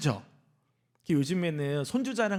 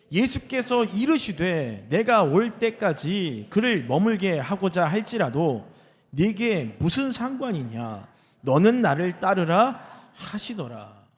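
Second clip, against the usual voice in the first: slow, emphatic Bible reading aloud; solemnly measured pulpit reading; scripture reading; 4 dB louder, much slower than usual. Acted. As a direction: proclaim the verse word by word, slowly.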